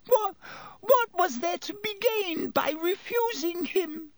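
high, stammering frightened whine; gasped excuse. A narrator's reading. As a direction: plead helplessly in a very high voice. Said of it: "gasped Pettigrew".

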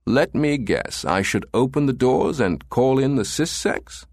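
lilting, sceptical amusement; amused. A narrator's reading. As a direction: toy with him, drawing out the suggestion. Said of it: "Strathmore chuckled".

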